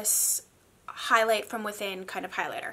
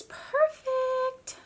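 much higher and very slow, savoring; drawn-out praise. Real, not acted. Vlogger praising the blush trio, stretched high. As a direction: stretch the word out in delight.

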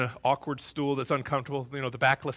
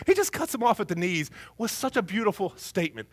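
ordinary talk

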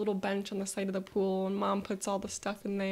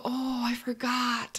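put-on slow, drawn-out kid exclamation; sudden remembering; 3 semitones higher, slower than usual. Child offered forgotten candy, sudden remembering. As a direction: stretch it out as it dawns on you. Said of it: "And I go".

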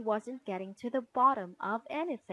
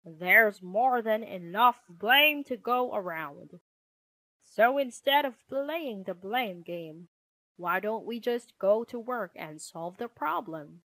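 firm, take-charge father; projected, authoritative dad-bear voice; Papa Bear; 7 dB louder than usual. Acted. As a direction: settle the squabble and give out jobs.

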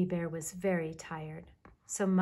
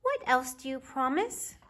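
put-on high, louder child's question; little bear cub; 7 semitones higher, 4 dB louder than usual. A narrator's reading. Baby Bear asks Mama while walking the riverbank, little cub voice. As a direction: ask Mama eagerly, pitched up high.

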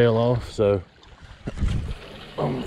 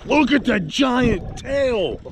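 excited; 10 semitones higher, much slower than usual. Real, not acted. Seeing the giant tail.

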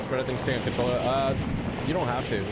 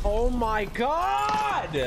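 slow, loud, high exasperated cry; drawn-out groan; 10 semitones higher, 4 dB louder, much slower than usual. Real, not acted. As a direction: stretch it out in disbelief. Frustrated in game.

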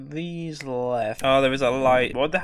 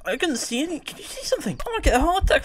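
startled high question; 13 semitones higher, faster than usual. Real, not acted. Startled in dark house.

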